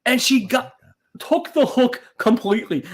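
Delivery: usual speaking voice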